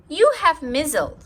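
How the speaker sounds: a high, loud little doctor pronouncing; doctor Ted. Acted. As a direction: announce it like a proud kid doctor. Doctor Ted diagnosing his mother.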